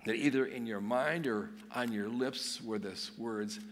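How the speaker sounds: usual speaking voice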